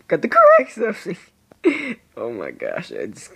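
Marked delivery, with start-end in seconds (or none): 0.1-1.2 much higher, louder and quick; higher retake. Pretend. Gamer repeating himself in a higher, louder voice. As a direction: fire it off up high.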